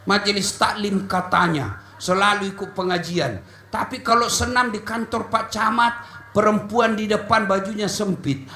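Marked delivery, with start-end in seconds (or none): none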